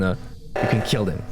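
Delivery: ordinary talk, nothing marked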